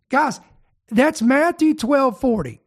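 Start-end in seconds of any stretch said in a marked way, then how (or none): none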